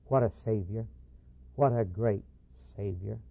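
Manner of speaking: quiet, choked-up wonder at the Savior; moved to tears. Retold or imitated. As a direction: let it fall away softly, overcome with reverence. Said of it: "Tears were in both their eyes".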